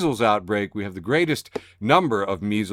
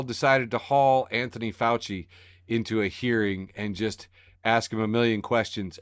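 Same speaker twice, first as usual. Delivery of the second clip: ordinary talk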